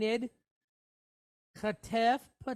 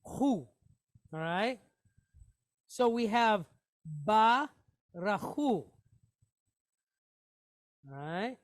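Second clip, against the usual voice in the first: usual speaking voice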